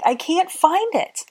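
slow, high distressed cry; cried out. A narrator's reading. Hermione still hunting for her wand, cried out. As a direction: draw out the desperate cry. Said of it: "Hermione cried".

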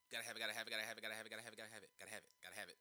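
rapid-fire craving chant; really fast. Shown as a demonstration. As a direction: rattle it off without a breath, tailing off. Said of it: "I said it really fast".

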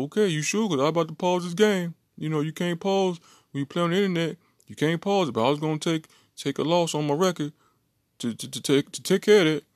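high hammed-up boyfriend voice; mock boyfriend. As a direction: play the eager gamer boyfriend.

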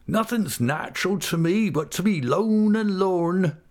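high, drawn-out plaintive lament; forlorn widow. A narrator's reading. Missus Gummidge refusing to cheer up, high forlorn voice. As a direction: wail it in self-pity.

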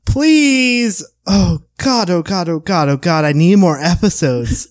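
hammed-up pleading; desperate begging. Reading fan email's desperate plea.

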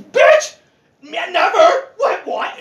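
high, loud shrieking rant; mock outraged husband yelling; furious Joseph. Joseph yelling at pregnant Mary, high shriek. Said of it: "He was like".

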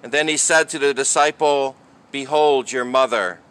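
solemn proclamation read aloud; gospel reading. Real, not acted.

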